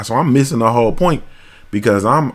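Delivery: usual speaking voice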